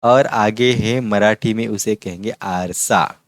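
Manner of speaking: ordinary talk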